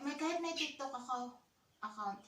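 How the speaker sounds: ordinary delivery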